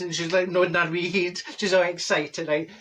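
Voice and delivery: ordinary delivery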